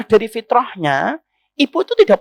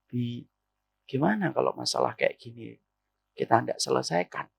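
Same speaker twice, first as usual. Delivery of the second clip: much lower and softer, gentle wife's plea; hushed low-pitched coaxing to a husband; 10 semitones lower, 12 dB quieter than usual. Shown as a demonstration. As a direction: keep it low and tender, no edge. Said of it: "Coba, Bu, ngomong kayak gitu".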